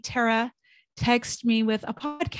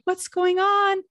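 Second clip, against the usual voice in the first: higher, worried asking what happened; alarmed question; 9 semitones higher, 3 dB louder, slower than usual. Retold or imitated. Answering friend Tara's plea for help, alarmed. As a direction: pitch it up in sudden worry. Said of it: "I was like".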